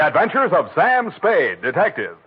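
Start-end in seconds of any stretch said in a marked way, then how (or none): none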